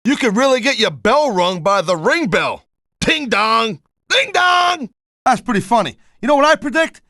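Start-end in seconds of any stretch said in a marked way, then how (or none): 3.0-3.8 ding dong in usual pitch; mock bell chime. Imitating the ring bell. 4.1-4.9 ding dong pitched way up; high mock bell chime. Imitating the ring bell, second time.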